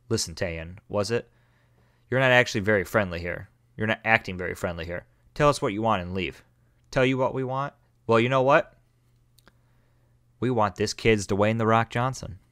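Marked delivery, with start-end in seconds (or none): none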